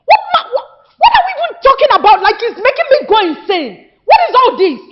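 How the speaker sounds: high, loud, incredulous outrage; exasperated outburst. Acted. Sister berating brothers after father's death, exasperated. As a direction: burst out in fast, rising exasperation. Daughter erupts at brothers over burial.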